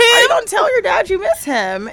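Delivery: loud, high, hammed-up urging; urgent prompting. Mom prods her son to tell dad, urgent prompting. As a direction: push the question out high and loud. Repeats the prompt over laughter.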